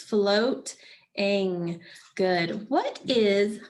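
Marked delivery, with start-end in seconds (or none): 0.0-1.8 "Floating" broken in two, float, pause, ing; split into syllables. Shown as a demonstration. Teacher showing the class the two syllables, "Floating" split apart. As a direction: say "Floating" syllable by syllable with a clear gap. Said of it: "Those are the two syllables".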